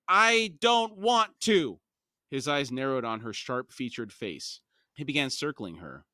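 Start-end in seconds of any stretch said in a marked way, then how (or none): 0.1-1.8 high, slow, drawn-out character shout; hammed-up high-pitched protest. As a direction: yell it back stubbornly, stretching each word. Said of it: "Mogora shouted".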